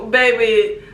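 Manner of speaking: ordinary delivery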